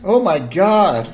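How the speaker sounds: high, loud, drawn-out mock horror; shocked audience gasp. Audience hearing awful news, slow high cry of shock. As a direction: cry out slowly in acted shock. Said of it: "everybody in the audience is like".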